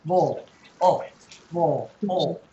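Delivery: "vol" with its vowel swallowed; unstressed second syllable. Shown as a demonstration. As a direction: say "vol" as a dull, weak syllable on its own. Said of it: "The second one is".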